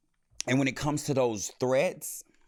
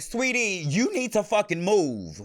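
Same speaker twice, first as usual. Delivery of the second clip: firm angry demand; 7 semitones higher, 3 dB louder, slower than usual. Retold or imitated. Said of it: "So now that he done said that".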